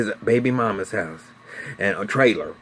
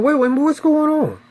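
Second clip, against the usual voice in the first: alarmed; 14 semitones higher, 4 dB louder than usual. Retold or imitated. Family rushes out alarmed.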